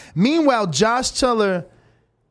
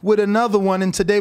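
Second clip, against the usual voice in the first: radio host intro; 4 semitones higher than usual. Acted. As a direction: welcome the listeners like a radio host. Host opens the radio show.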